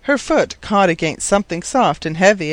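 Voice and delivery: usual speaking voice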